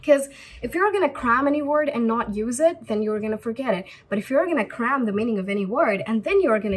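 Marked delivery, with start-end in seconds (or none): none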